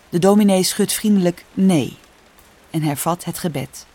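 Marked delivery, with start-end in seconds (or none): none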